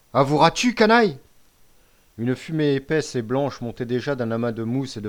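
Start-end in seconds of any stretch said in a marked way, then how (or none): 0.1-1.2 loud demand climbing high; angry demand. A narrator's reading. Chief pressing the captive to confess, angry shout. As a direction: force a confession out.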